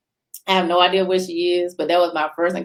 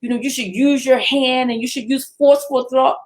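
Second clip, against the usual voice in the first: nurse's lecture; 6 semitones higher than usual. Retold or imitated. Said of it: "talking about how she".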